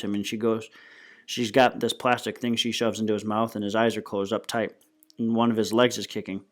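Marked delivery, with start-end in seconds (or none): none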